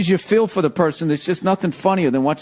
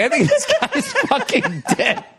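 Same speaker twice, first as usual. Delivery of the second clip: laughing. Retold or imitated. Retelling a shocking wipeout.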